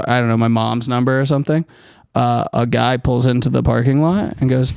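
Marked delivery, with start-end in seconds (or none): none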